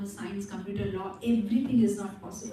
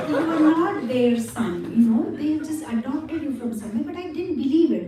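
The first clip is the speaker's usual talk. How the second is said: louder, laughing through the teasing; laughing tease; 4 semitones higher, 7 dB louder, faster than usual. Acted. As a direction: tease her while laughing.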